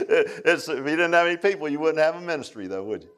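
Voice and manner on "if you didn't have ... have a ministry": usual speaking voice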